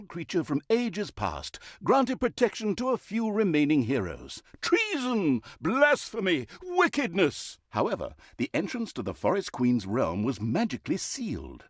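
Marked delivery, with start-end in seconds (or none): 4.6-7.6 high, hammed-up indignant cries; mock outrage. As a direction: play scandalised villain. Outraged exclamations at the Forest Queen.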